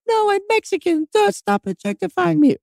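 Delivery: very high, frightened squeak; squeaky scared self. Pretend. Pleading to Russian thugs in a squeaky high voice. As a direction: squeal it like a panicked kid.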